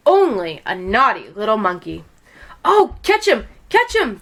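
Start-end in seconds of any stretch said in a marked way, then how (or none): 2.6-4.2 crying out. A narrator's reading. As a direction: sound the chase. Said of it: "they cried".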